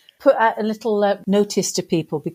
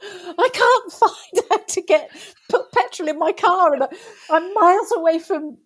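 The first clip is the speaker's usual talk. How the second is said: high-pitched mock-frantic complaint; rabid ranting; 8 semitones higher than usual. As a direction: re-enact a frantic, exasperated rant. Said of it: "he must have thought I was absolutely rabid".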